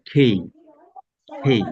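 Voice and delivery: "খেই" with a puff of air on the k; strongly aspirated "খেই". Shown as a demonstration. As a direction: open "খেই" on kh and glide to ey.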